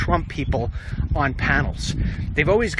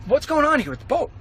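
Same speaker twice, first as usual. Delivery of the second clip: irritated; 10 semitones higher than usual. Retold or imitated. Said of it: "I'm not being cool about it".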